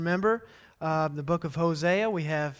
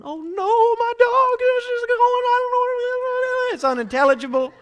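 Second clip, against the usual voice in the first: very high, louder panicked wife voice; mock panic, much higher and louder; 8 dB louder than usual. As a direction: imitate the wife freaking out, slowing on the words. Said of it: "she's freaking out".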